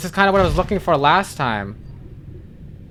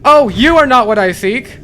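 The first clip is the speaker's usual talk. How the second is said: higher, louder retort with rising stress on are; defiant shout; 5 semitones higher, 6 dB louder than usual. Real, not acted. Player shouting back at the alien voice, defiant shout. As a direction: throw the words back at it.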